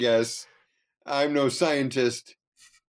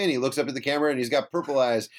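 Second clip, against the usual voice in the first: usual speaking voice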